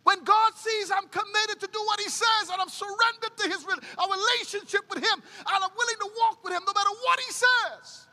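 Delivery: high, loud pulpit preaching; preaching at full force. Acted.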